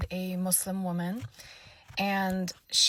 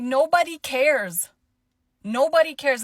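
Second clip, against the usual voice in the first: cutting dismissal; 6 semitones higher, 9 dB louder than usual. Retold or imitated. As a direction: shut her down, word by word. Speaker cuts lady off.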